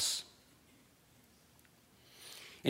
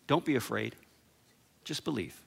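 gentle reassurance; 4 semitones lower, 11 dB quieter than usual. Acted. Jesus comforting the grieving father.

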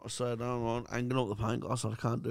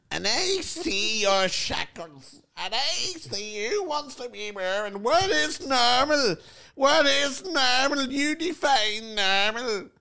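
slow, higher, louder mock guru preaching; mocking Dylan's mystic voice; 8 semitones higher, 4 dB louder, slower than usual. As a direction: ham up a know-it-all spiritual sage, drawn out. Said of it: "Oh, Dylan, don't start all this fucking".

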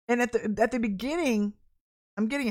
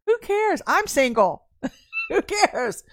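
high, loud playful exclamation with laughter; comic indignant cry, half laughing; mock defiant outburst; 9 semitones higher, 7 dB louder, slower than usual. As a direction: belt it out high, laughing at yourself. Mock outburst after laughing at herself.

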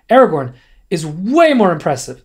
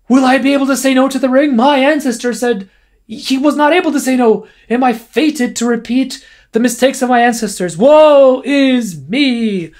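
mock whining lament; 8 semitones higher, 5 dB louder than usual. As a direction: wail like a melodramatic hero. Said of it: "blah, blah, whine, whine".

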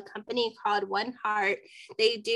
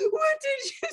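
crying shout. Retold or imitated. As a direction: cry out in panic, half sobbing. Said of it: "hysterically crying".

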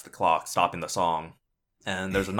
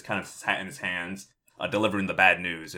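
ordinary delivery

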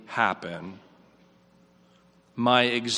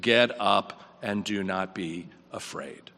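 slow, grave quote; 6 semitones lower, 5 dB quieter than usual. Retold or imitated. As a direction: lower and slower, each word weighed. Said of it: "what Jesus says is".

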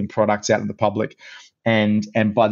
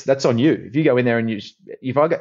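normal talking voice

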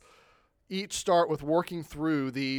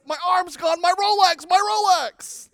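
very high, loud mock wail, slowing down; wailing car owner; 9 dB louder than usual. Driver mourns his Rolex to the officer, very high hammed-up wail. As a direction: whine for the watch like a spoiled man. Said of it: "And he said".

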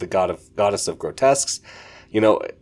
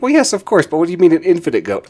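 much higher, louder and fast; flustered Isolde; 10 semitones higher, 7 dB louder, faster than usual. Retold or imitated. Isolde snapping at the dragon, high, loud and quick. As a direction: rush it out, squeaky and indignant. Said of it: "He's like".

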